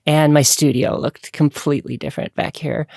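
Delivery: ordinary talk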